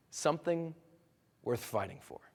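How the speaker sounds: low, soft, with a weighty pause; solemn reveal. Retold or imitated. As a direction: quietly land the moral. Lion tamer delivers the story's lesson.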